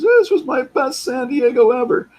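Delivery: high, loud put-on voice of a friend; imitating Kellett. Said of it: "That's how he talks".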